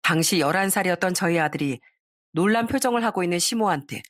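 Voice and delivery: ordinary delivery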